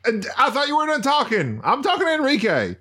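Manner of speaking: high, exasperated teasing; mock scolding. Real, not acted. Co-host telling host he's interviewing Enrique, mock scolding. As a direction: shut him down in playful exasperation.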